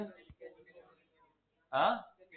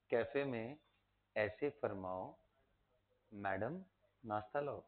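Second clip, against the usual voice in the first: softer slow repeat; 5 semitones lower, 7 dB quieter than usual. Shown as a demonstration. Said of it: "उसके बाद बोला".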